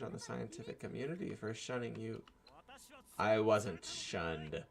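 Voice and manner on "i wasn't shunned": slow, drawn-out furious shriek, much louder; sudden shriek. Acted. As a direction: explode at her, stretching each word. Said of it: "Nakabachi suddenly shrieks".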